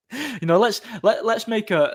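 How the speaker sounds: high, sarcastic mock voice of a corporate hypocrite; mocking game maker. Mocking anti-capitalist game makers in a high sarcastic voice. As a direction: squeak it out like a smug executive.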